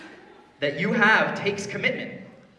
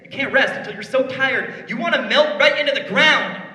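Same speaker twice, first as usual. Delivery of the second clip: rising higher and louder into a yell; building to a shout; 7 semitones higher, 8 dB louder than usual. Acted. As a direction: pump him up until you're yelling. Escalating motivational rant.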